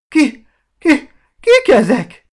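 high, loud, stammering fright; terrified stammer. A narrator's reading. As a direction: stutter it out half-fainting with fear. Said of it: "A félelemtől félájultan kérdezte".

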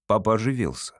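ordinary delivery

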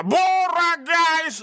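high, slow, drawn-out call of Bara guys; random man calling out. Retold or imitated. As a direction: stretch "Bara guys!" out high like a stranger yelling in the street. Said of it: "mannen från ingenstans ropa".

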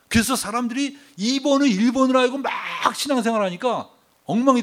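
high, loud, emphatic sermon; preacher in full cry; forceful preaching. Real, not acted. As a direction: drive the point home to the congregation. Preacher drives home the main point.

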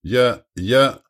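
halting, caught off guard; flustered faltering. A narrator's reading. Duke lost at the turn of talk, faltering. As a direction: falter on the words, thrown. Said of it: "замялся герцог Боспорский".

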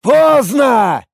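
high, loud, stretched bellow; roaring. A narrator's reading. Warrior bringing his weapon down, roaring. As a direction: roar it as the blow falls. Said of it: "заревел тот".

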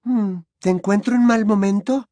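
lightly voiced timid girl; shy girl voice. A narrator's reading. Cho speaks shyly.

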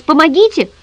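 high, loud desperate yell; panicked high-pitched cry; crying out for help. A narrator's reading. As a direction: shout for help at full voice, cut short. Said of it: "заорал в голос".

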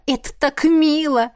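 high, delighted cooing; gushing exclamation. A narrator's reading. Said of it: "воскликнула".

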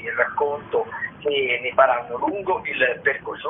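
usual speaking voice

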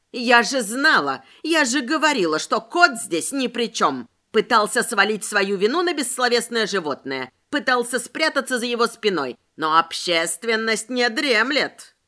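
plain reading voice

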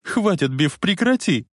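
tearful cry. A narrator's reading. Lorraine begging Biff to stop, tearful cry. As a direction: cry out, barely holding back tears. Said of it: "едва сдерживая слёзы, закричала Лоррейн".

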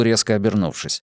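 plain reading voice

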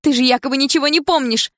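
high, loud, weeping reproach; tearful outburst. Acted. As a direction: cry it out at him. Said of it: "чувствуя, как заструились по щекам слёзы".

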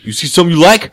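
high, loud imitation of an aggressor; mock hostile challenge. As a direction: call someone out as a stranger. Re-enacting stranger's sudden challenge.

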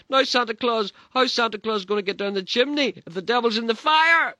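put-on very high, loud wailing kid; crying child. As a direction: wail it through tears. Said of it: "I started to cry and sobbed".

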